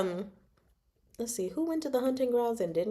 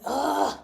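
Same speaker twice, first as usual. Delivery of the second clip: higher, exasperated fangirl groan; frustrated groan; 6 semitones higher than usual. Retold or imitated. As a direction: let out an exasperated "ugh". Fan frustrated by missed kiss.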